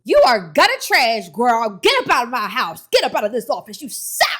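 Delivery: mock furious rant at a lazy mentee; mock harsh scolding. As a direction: play a cruel boss throwing her out. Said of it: "I wouldn't be like".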